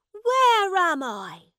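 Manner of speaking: high, drawn-out question; bewildered prince. A narrator's reading. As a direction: voice a lost prince, high and slow. Lost prince in strange room.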